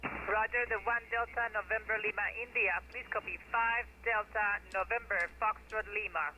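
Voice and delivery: mistuned ham radio. Real, not acted. Same off-frequency station replying.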